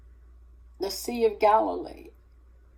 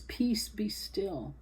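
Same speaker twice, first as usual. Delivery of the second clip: whispered command; 2 semitones higher, 9 dB quieter, slower than usual. Retold or imitated. Jesus speaking to the storm, calm breathy whisper. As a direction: whisper it slowly, barely voiced.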